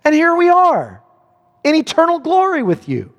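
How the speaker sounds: high, drawn-out cries of gratitude; exultant praise. Acted. Saints in heaven thanking God who saved them, exultant praise.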